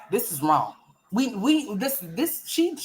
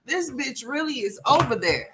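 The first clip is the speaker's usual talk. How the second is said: ordinary talk, nothing marked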